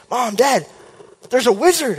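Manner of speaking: telling parents in a rush; urgent alarm. Retold or imitated.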